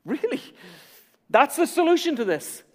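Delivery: high, rising mock incredulity; mock disbelief. Preacher voices a doubting listener, mock surprise. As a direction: play the doubter in the pews.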